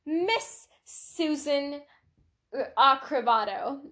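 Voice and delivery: drawn-out host calling out a contestant; pageant host. Acted. Host calls on pageant model at the microphone, slow announcement. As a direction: announce the contestant slowly, pausing before the surname. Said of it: "when the host asks them".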